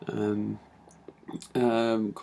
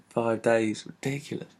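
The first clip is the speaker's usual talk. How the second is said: ordinary talk, nothing marked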